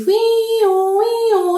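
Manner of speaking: high sung siren wiu wiu; quick playful siren wail; siren imitation. Shown as a demonstration. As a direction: demo the siren sound. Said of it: "efek-efek bunyi ambulans seperti".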